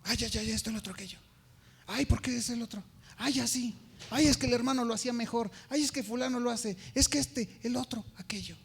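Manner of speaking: mocking a gossip's complaints. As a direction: rattle it off like a church busybody. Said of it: "otra cosa es que empieces a".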